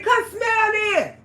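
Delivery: slow high yell, come stressed; retold shout. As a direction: holler it at him, slow and loud. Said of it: "I said".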